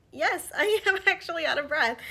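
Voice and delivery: loud, high-pitched laughing admission; emphatic mock retort. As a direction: answer back emphatically, laughing through it.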